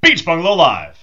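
high, loud title shout; big falling flourish on the title; show-opening announcer. Real, not acted. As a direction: call out the show name to the crowd.

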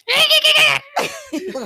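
high shrieking monkey hoot; chimp hooting. Retold or imitated. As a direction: screech like an angry chimp. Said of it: "Why you gotta sound like that?".

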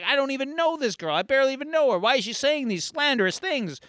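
high-pitched flustered denial; indignant protest. Retold or imitated. Hill denies knowing the girl, high indignant voice. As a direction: protest as a wronged, exasperated candidate. Slandered politician protests, acted.

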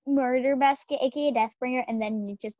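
ordinary talk

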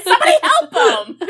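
high, laughing mock panic; laughing high plea. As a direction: beg for help through laughter. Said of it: "I was like".

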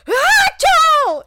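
huge booming high achoo; dad's sneeze. Retold or imitated. Imitating her dad's loud scary sneeze. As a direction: blast out a startling sneeze. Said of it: "my dad sneezes like that too".

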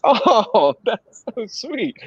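high, drawn-out cooing; touched gushing. Retold or imitated. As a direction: imitate a woman melting at something cute. Voicing the woman's touched reply.

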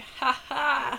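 put-on laugh at someone else's fight; mock gleeful laugh. Looking out the window at a fight, mock gleeful laugh. Acted reaction to watching fights.

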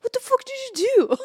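mock shout at the sister; alarmed brother. Brother confronting his sister, imitated alarmed shout.

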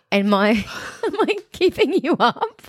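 high squeaky laugh through words; stifled giggles. Real, not acted. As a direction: hold back a giggle as you speak. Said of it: "trying not to laugh really loud".

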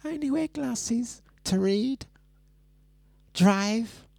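very high put-on impression voice; Drea. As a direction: list excuses in a high little voice, pausing between items. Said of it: "Let me do my Drea impression".

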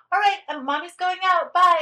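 high, loud, chirpy goodbye; breezy departing mom. Retold or imitated. Nanny mimics a parent leaving the baby with her, high and chirpy. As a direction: toss off a cheerful bye to the baby.

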